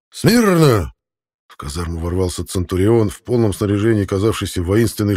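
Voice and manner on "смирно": high, loud command; drill-sergeant shout. A narrator's reading. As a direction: bark it from the doorway. Centurion barges in.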